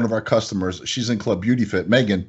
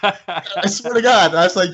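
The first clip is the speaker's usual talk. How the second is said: ordinary delivery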